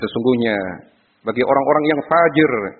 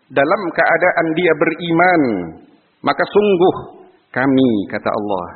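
ordinary delivery

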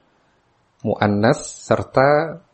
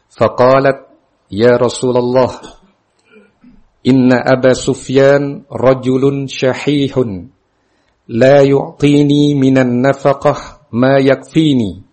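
hadith recitation; 3 semitones higher, 5 dB louder than usual. A narrator's reading. Teacher recites Hind's question to the Prophet, formal recitation. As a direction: project the text in measured phrases. Reads the Arabic hadith aloud.

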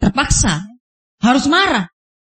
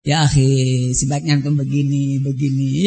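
much lower, even advising tone; mock adviser; 11 semitones lower than usual. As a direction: play a patronising adviser in a low monotone. Imitating someone giving advice. (menirukan penasihat dengan suara rendah dan datar)

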